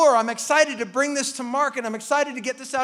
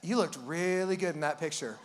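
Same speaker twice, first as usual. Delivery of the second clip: wife's deadpan jab; 9 semitones lower, 11 dB quieter than usual. Retold or imitated. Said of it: "All she says is".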